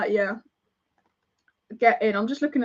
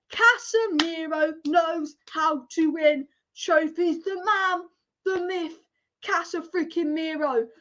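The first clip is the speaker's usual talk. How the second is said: ordinary talk, nothing marked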